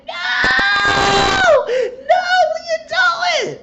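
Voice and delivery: very high, loud retold shriek; hammed-up falsetto wail of protest; mock horrified scream. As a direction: imitate a mother screaming in horror. Retold mother screaming as son kisses Justin.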